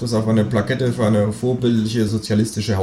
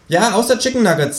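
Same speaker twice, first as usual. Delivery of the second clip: much higher, cheeky kangaroo; 14 semitones higher, 3 dB louder than usual. Acted. Kangaroo reassuring the narrator, high and cheeky. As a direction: pitch way up for the brash kangaroo.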